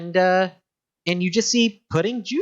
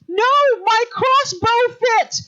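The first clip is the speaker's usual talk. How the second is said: high, loud shouting squeal; second employee shrieking high and loud as the pudding attacks; shouting darkling; 6 dB louder, much slower than usual. Acted. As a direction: shriek in despair. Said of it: "the other one says".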